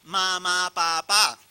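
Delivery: lightly voiced baby saying mamá, papá; a hint of a small child trying out its first words; toddler's first words. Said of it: "Está tratando de hablar y dice".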